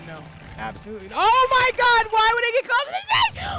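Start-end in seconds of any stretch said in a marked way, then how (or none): 1.1-2.1 startled high yell, much louder; jump-scared shriek; panicked scream at a spider monster; real scream of fright. Real, not acted. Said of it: "I was screaming". 2.1-3.6 high, loud, fast panicked cry; yelling in real fright; terrified rushed yell; frightened yelling. Real, not acted. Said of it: "I was screaming".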